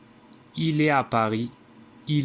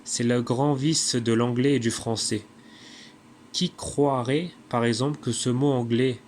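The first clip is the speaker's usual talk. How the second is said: normal talking voice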